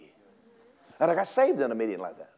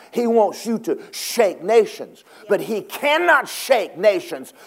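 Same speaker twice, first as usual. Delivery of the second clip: loud, high preaching climax; full-force preaching; 6 semitones higher, 8 dB louder than usual. Acted. Preacher rallying the church, loud and high. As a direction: preach it hard to the congregation.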